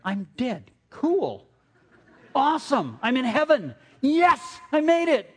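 high-pitched pretend jubilation; thrilled celebration. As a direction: play overjoyed at arriving in heaven, voice leaping up. Imagines waking up in heaven.

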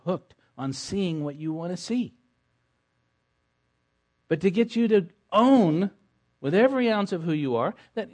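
high, loud, fervent preaching; preaching at full force. Acted. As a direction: drive it home like a revival preacher.

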